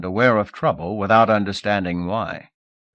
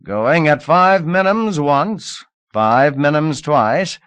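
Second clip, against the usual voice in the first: slow auction drone, higher and louder; droning; 7 semitones higher, 7 dB louder, slower than usual. Acted. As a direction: drone the call out slowly. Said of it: "started droning".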